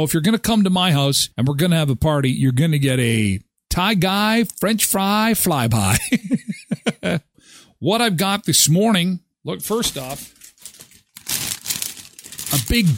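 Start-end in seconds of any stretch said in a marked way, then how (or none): 6.0-7.2 short real chuckle; amused laugh. Real, not acted. Host laughing at own pun, amused chuckle.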